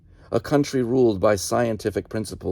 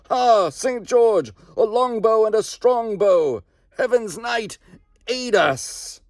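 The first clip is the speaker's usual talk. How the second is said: much higher, ringing war cries; host of shouting voices; 11 semitones higher, slower than usual. Acted. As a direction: call on the saint as a ghostly army. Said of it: "thousands shouting".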